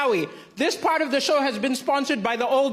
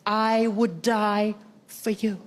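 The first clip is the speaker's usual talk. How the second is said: tender declaration; 6 semitones lower, much slower than usual. Retold or imitated. Ex tells him she would die for him, slow and tender. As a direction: whisper it slowly like a vow. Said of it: "she said".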